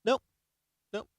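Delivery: ordinary delivery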